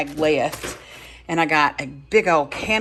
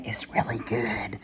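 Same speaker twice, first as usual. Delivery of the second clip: hushed whisper of approval; 6 semitones lower, 10 dB quieter than usual. Real, not acted. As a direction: whisper it like a secret. Aside about tasting the soup.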